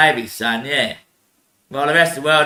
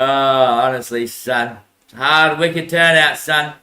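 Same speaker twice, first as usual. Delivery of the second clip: usual speaking voice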